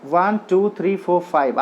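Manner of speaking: वन, टू, three, फोर run together in one quick, even sweep; quick recount. Shown as a demonstration. Teacher recounts the feet for students in one quick run. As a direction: rattle off वन, टू, three, फोर quickly without pauses. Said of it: "आप देख रहे हैं यहाँ five feet हैं".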